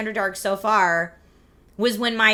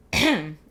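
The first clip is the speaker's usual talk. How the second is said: throat clear; 3 semitones lower, 4 dB louder than usual. Real, not acted. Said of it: "Excuse my little Tiny Tim cough".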